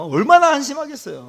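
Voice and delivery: loud, high preaching outburst; emphatic exclamation. Real, not acted. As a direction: exclaim it with force. Exclaims, then repeats it softly. (목청 높여 한탄하듯)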